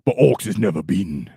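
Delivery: gruff ork reading of the chat line; a streamer voicing a viewer as an ork. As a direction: say it like a stubborn orc.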